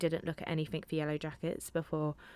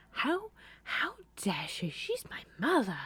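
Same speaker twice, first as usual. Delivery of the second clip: mock outrage; 8 semitones higher than usual.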